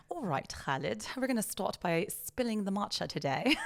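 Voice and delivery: upper-class British matcha queen; playful mock-posh British; British accent. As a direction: put on a proper, regal British accent. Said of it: "in Brit-, in British".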